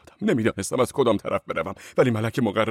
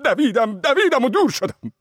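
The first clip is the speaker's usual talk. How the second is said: much higher, louder urgent running; breathless flight; 13 semitones higher, 5 dB louder than usual. A narrator's reading. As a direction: pitch it up as he flees faster. Fleeing faster, voice climbs.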